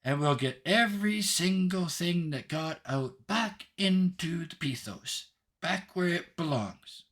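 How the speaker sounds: put-on high, emphatic little-girl voice; pointed childlike declaration, pitched well up; tiny, insolent Hope. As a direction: insist on it like a determined child. Hope addresses Pandora.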